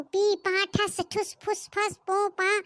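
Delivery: slow spluttering fart sounds with the lips; mock fart noises. Mother-in-law mimics the bride's farts, mocking. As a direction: sputter the noises out slowly.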